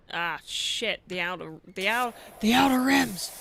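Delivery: loud, rising cry of dismay; dismayed exclamation. Real, not acted. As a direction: realize the mistake and yelp. Sudden mistake noticed, exclaims.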